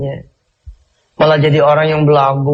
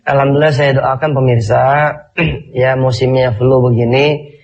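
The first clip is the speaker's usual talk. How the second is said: ordinary talk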